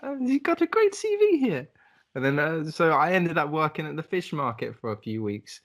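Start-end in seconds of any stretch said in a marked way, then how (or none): 0.0-1.7 much higher, put-on voice of the interviewer; posh boss impression. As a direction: play the impressed fish-market boss. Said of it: "he was like".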